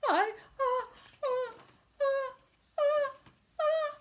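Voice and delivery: sneeze building. Acted. Gerald about to sneeze.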